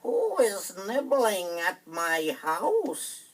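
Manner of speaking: high, slow, loud sing-song witch. Acted. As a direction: croon it slowly like a lurking witch. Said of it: "they heard a voice saying".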